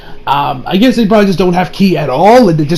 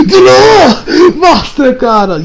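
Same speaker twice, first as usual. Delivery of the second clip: higher and slower, even more dramatic anime shout; bigger duel shout; 8 semitones higher, 4 dB louder, much slower than usual. Retold or imitated. Anime hero repeating the duel call, higher drawn-out shout. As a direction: top the first call, stretch every word. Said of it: "watched the clip".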